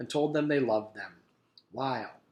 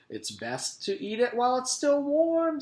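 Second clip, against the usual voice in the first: cafeteria lady voice; 12 semitones higher, 4 dB louder than usual. A narrator's reading. Miss Mush voice.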